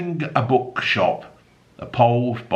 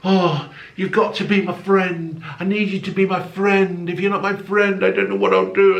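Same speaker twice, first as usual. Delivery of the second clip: mock needy coworker; 8 semitones higher than usual. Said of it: "that they say".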